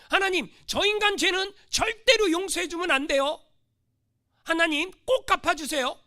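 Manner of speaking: loud, high, insistent mock prayer; vengeful plea. Preacher acting a grudge-holder praying to God, loud demanding plea. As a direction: beg God hard for payback.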